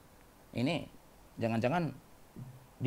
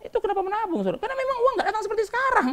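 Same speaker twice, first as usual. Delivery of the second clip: high-pitched insistence; 3 dB louder, faster than usual. Real, not acted. Preacher telling the congregation why to save, high-pitched insistence. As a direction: point repeated in a much higher voice.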